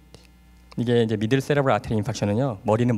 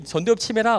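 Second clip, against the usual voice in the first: ordinary talk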